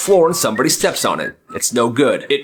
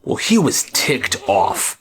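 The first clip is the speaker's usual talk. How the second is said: usual speaking voice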